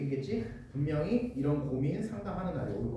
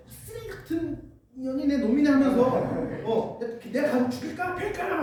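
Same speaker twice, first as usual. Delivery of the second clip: higher-pitched, hammed-up rage for a friend; mock fury; 7 semitones higher, 3 dB louder than usual. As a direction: act outraged on your friend's behalf. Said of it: "막 이러면서". (친구 편에서 과장되게 흥분한 목소리)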